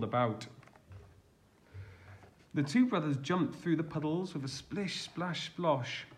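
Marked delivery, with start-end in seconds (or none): none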